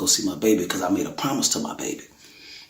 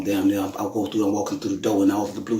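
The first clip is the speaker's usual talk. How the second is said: usual speaking voice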